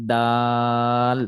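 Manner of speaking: "দাল" stretched very long on one even note; slow, monotone recited "দাল"; four-count long "দাল". Shown as a demonstration. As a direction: hold the aa of "দাল" for four beats. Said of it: "লম্বা করে পড়তে হবে".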